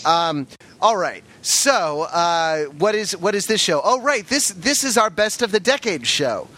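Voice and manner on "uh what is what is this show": ordinary talk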